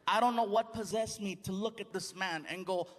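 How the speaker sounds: ordinary talk, nothing marked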